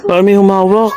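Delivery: panting and shaken after the run; while running. Real, not acted. Just ran out, someone grabbed them.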